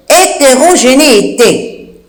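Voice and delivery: spell-it-out pace on "hétérogénéité". Shown as a demonstration. Dictates a hard word slowly.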